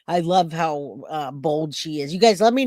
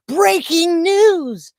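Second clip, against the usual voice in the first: slow, much higher, louder announcer boom; Nancy Grace; 11 semitones higher, 8 dB louder, much slower than usual. Retold or imitated. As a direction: imitate the dramatic anchor. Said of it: "that's how she sounds".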